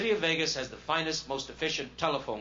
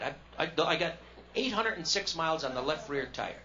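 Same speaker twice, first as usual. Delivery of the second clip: ordinary talk, nothing marked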